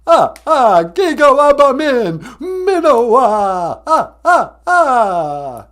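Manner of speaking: loud Count farewell, slow and sobbing; the Count saying goodbye in Ojibwe. Acted. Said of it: "the Count".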